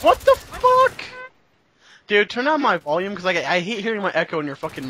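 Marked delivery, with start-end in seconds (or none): 0.0-1.0 very high, loud, drawn-out disbelief; incredulous high-pitched cry; shocked outburst. Real, not acted. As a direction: yelp it in real gamer outrage. Sudden upset during the game.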